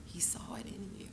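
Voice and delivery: soft unvoiced whisper of encouragement; hushed urging. Mother whispering to her daughter to keep going. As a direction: whisper it gently to the grieving child.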